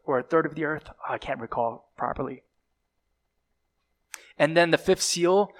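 ordinary talk, nothing marked

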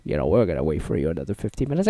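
low, soft, laid-back reply; lazy gate agent. Retold or imitated. Gate agent calmly telling the tourist to relax. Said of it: "I was like".

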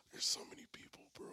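breathy whisper to himself. Real, not acted. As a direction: whisper it, overwhelmed by the options. Overwhelmed by choices, whispering.

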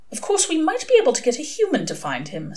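mildly pondering; thoughtful. A narrator's reading. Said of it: "said Aziraphale thoughtfully".

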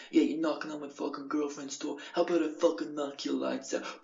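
lightly voiced angry boyfriend; imagined aggressor, mildly hammed up; threatening boyfriend. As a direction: put on a bit of a tough guy's threat. Imagined threatening boyfriend confronts him.

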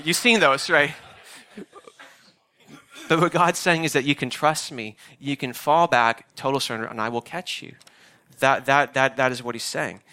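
ordinary talk, nothing marked

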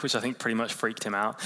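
ordinary delivery

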